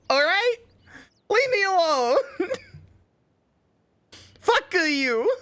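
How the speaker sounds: high-pitched defensive whine; squeaky high voice. Real, not acted. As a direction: squeal it out, flustered. Defensive squeaky bat chest rant.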